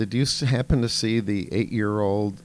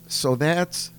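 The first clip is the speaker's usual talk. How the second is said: higher, louder mock child voice; a hammed-up schoolgirl presenter, pitched up; imitating a little girl; 8 semitones higher, 4 dB louder than usual. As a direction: play the kid doing a grown-up speech, higher and louder. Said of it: "And she goes".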